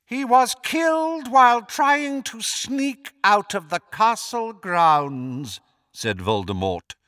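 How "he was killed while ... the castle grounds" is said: high, drawn-out villain voice savouring a lie; relishing the lie. A narrator's reading. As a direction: relish every word as the cold villain. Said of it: "relish in his voice for the lie".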